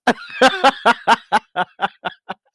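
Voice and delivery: loud, high bursts of real laughter. Real, not acted. Laughing at how bad her life had been. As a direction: crack up at the memory.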